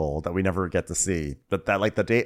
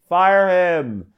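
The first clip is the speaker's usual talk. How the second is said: loud, high-pitched command; mock outburst; 9 semitones higher, 6 dB louder than usual. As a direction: blurt out the verdict at high volume. Blurted answer to retold problem.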